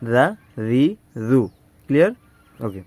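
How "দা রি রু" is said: "দা, রি, রু" in short, quick syllables; quick, not dragged. Shown as a demonstration. Teacher shows students the right way, quick syllables. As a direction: cut the vowels short on "দা, রি, রু". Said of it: "এভাবে টেনে না".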